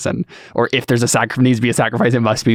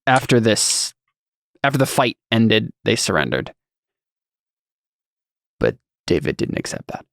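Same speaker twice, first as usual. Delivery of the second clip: halting, lost for words. Acted. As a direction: restart haltingly, long pauses between phrases. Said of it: "don't know how to say the rest of it".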